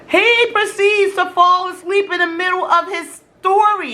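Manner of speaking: ordinary talk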